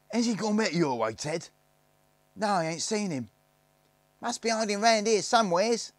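put-on searching kids calling. A narrator's reading. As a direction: shout to your mates through the bushes. Snargits calling to each other.

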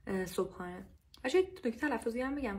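usual speaking voice

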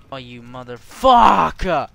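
slow curse swelling to a loud yell; exasperated curse. Real, not acted. Player cursing his game opponent, exasperated yell. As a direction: drag it out and explode on the swear.

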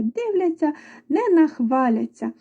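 usual speaking voice

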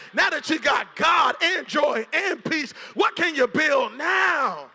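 loud, rising sermon peak; preaching at full force. Real, not acted. Pastor urging the church to dream again, shouting.

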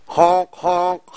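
honking like a goose. Acted. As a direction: goose honks. Goose sound imitated.

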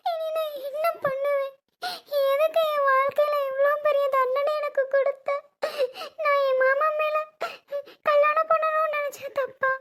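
high, tearful wailing to God; crying lament. Acted. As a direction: sob it out, pleading with God. Wife weeps over losing child. (அழுதுகொண்டே கடவுளிடம் புலம்பல்)

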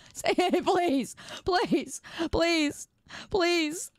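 high-pitched mock begging; playful pleading. Streamer begging someone to say it, mock pleading. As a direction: beg in a little high voice.

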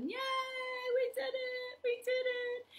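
high delighted cheer; excited cheer. Real, not acted. Celebrating finished song.

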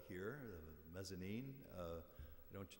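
ordinary talk

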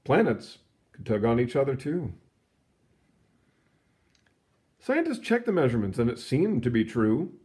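lively read-aloud storybook voice; story narration. A narrator's reading. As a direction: read it like a picture book to kids.

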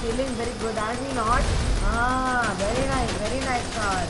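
playful mock Indian accent praising the car. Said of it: "change our accent to Indian accent".

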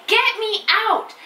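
high, drawn-out mock shout; storybook yell kept at reading volume; shouted in story. A narrator's reading. Child in bedtime story wants out, mock shouting. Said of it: "in the end I shouted".

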